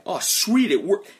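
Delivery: high, loud acted delight; exclaiming to the sky. Caster looks up towards the sky, delighted exclamation. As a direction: burst out as if the spell worked. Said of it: "you just say towards the sky".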